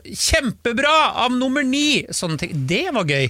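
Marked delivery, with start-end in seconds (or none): 0.1-2.0 higher, cheering from the stands; a silly fan's praise shouted out; mock fan cheer. Silly fan praising number nine, mock cheer. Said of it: "jeg pleide å rope sånn drithøyt sånn".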